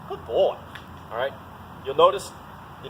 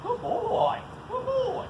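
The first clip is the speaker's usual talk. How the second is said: squeaky high praise for the dog; high excited dog praise; much slower than usual. Real, not acted.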